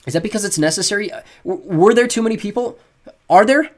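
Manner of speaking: pressing for an answer he dreads; anxious questions. A narrator's reading. Boy asking his friend's dad, anxious and rising.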